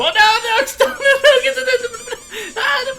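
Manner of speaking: high panicked laughing screams; panicked screaming. Real, not acted. Attacked by monster, screaming.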